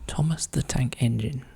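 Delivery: quiet whispered repeat of the clue. Real, not acted. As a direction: whisper it to yourself, thinking.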